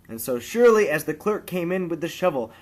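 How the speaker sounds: ordinary delivery, nothing marked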